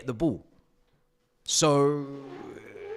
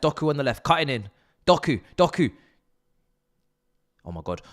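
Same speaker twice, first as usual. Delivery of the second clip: usual speaking voice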